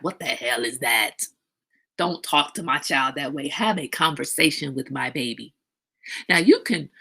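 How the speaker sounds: ordinary delivery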